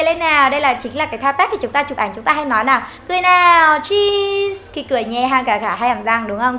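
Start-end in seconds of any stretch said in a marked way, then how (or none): 3.1-4.7 high, calling, smiling "cheese"; rally everyone to smile for a snapshot; photo-time cheese. Shown as a demonstration. Photo pose call to smile.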